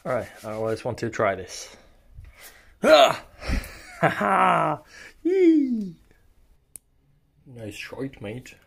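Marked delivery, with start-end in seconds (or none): 2.8-5.9 high, loud excited yelps; excited whoop. Real, not acted. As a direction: whoop with delight. Excited at trying a trick.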